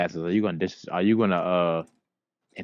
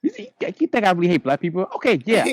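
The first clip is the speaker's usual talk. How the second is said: higher and louder, flustered mock defence; a hammed-up flustered excuse; mock flustered celebrity; 11 semitones higher, 4 dB louder than usual. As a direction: play the caught celebrity scrambling.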